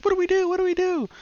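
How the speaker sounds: very high, frantic squeak; panicked woman. Retold or imitated. Girl panics after horde.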